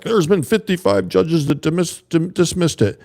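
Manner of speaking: high, whiny mocking voice; mock liberal. Mocking liberal critics citing the judges, high and whiny. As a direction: squeak it out like a smug liberal. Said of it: "liberal people are like".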